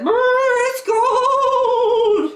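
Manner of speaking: very high, loud, drawn-out shivering squeal; freezing shiver. Acted. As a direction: squeal it with a long chattering "brr". Girl freezing out of water.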